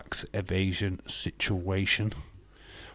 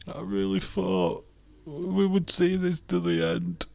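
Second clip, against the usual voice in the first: mock tearful Ronda; 9 semitones higher than usual. Streamer voices Ronda betrayed by her friend and chat, high mock-weepy whine. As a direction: ham up a wounded wrestler whining to her friend. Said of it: "fake tears and everything".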